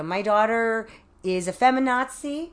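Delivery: hammed-up dad's declaration; father giving a toast. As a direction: imitate a proud, resigned toast to the room. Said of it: "He gave a toast".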